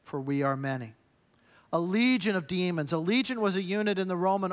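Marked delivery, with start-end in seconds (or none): 0.0-0.9 creepy reply. Retold or imitated. A demon-possessed man answering Jesus with creepy menace. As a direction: let the demon answer from somewhere deep. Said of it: "that very creepy reply".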